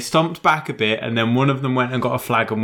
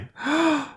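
sharp gasp; 3 dB quieter than usual. Real, not acted. Mock shock.

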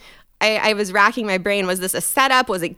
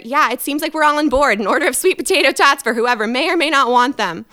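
overly cheerful; 6 semitones higher, 6 dB louder than usual. Retold or imitated. Overconfident order to waiter.

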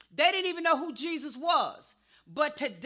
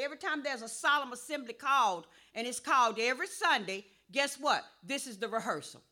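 usual speaking voice

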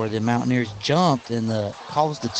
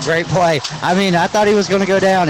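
excited; 6 semitones higher, 5 dB louder than usual. Real, not acted. Color man reacting to touchdown.

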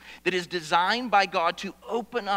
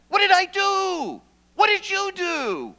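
anguished pleading; 12 semitones higher, 8 dB louder than usual. Acted. Psalmist's anguished questions to God.

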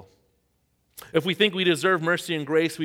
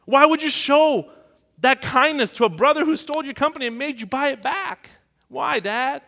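loud, much higher pleading outrage; hurt anger; 12 semitones higher, 8 dB louder than usual. Retold or imitated. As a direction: demand an answer from dad, furious. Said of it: "I got more angry the second time".

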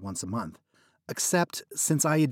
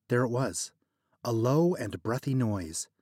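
plain reading voice

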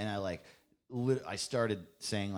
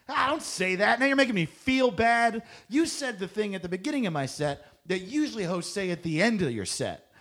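mock whiny complaint; 11 semitones higher, 5 dB louder than usual. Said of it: "he was like".

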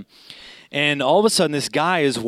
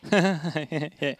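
softer, acted awkward chuckle; awkward laugh; 5 dB quieter than usual. Bystander caught between cop and yelling man, awkward laugh. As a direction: imitate laughing uneasily to stay out of it.

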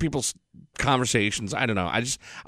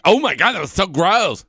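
higher, louder disgusted squeal; disgusted girlfriend; 9 semitones higher, 6 dB louder than usual. Retold or imitated. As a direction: mimic her grossed-out reaction. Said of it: "And she was like".

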